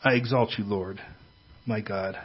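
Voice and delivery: soft, earnest prayer voice. Acted. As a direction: pray quietly and earnestly. Leads a prayer.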